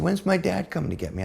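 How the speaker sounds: airy and pleased, no anger now; high giddy mother. Retold or imitated. Mellow mother asking son about her dad, high and light.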